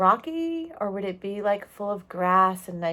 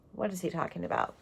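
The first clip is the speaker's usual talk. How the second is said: ordinary delivery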